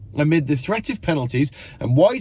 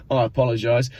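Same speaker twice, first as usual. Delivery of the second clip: ordinary talk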